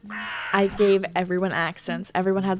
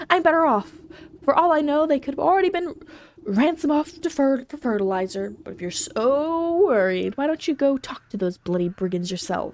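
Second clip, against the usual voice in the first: dismissive ox farmer. Acted. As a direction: send the nosy visitor packing. Voices the angry ox farmer.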